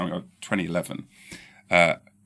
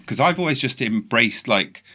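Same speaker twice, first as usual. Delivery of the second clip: normal talking voice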